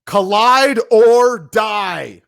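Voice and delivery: slow, booming wrestling catchphrase; shouted slogan. Pretend. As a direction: drag it out like a ring announcer. Dramatic slogan read.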